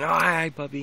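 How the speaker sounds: high, bright-eyed hi to a puppy; gushing hello to an excited puppy; excited puppy greeting. Retold or imitated. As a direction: squeal a happy hello at the pup. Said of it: "he gets very excited".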